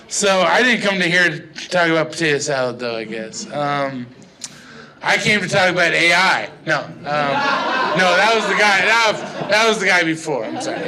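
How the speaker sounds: ordinary talk, nothing marked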